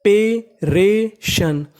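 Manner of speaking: teacher sounding out "preparation" slowly, each syllable apart. Shown as a demonstration. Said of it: "तो meaning बनी".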